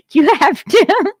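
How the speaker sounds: high giggle running into the words; can barely get the words out for laughing; laughing helplessly. Real, not acted. Streamer cracking up over a funny clip, laughing. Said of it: "I can't stop laughing".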